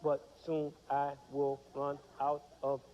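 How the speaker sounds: slow, unbroken monotone drone; droning on with no rests; monotone without pauses. Shown as a demonstration. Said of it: "what if we spoke like".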